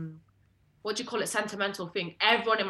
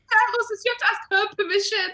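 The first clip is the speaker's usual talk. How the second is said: very high, loud squealed protest; retold shouting; 13 semitones higher, 6 dB louder than usual. Mimicking cousin protesting to air hostess, high outraged squeal. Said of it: "she starts shouting at me".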